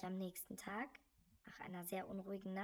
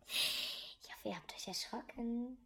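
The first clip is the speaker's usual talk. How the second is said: whispering; 2 semitones higher than usual. Acted. Whispered after scare.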